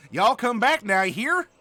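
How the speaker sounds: loud, high Southern send-off; big folksy Southern accent. Acted. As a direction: holler it with a Southern twang. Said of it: "the Southern accent or the drawl".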